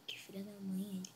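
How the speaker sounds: ordinary talk, nothing marked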